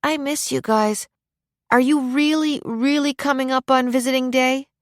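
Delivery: put-on high, wistful little-boy voice; young boy missing his family; wistful child. A narrator's reading. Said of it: "his tone became wistful".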